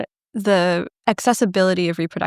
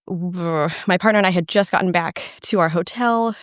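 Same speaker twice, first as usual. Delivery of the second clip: normal talking voice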